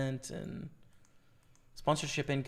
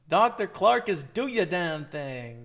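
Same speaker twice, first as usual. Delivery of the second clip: hype cheer; 3 semitones higher, 7 dB louder, slower than usual. Real, not acted. Cheering on a viewer.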